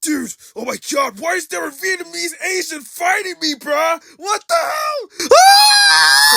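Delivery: high panicked shouting; panicked yelling. Acted. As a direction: scream like you're being attacked. Pretend fight, panicked reaction.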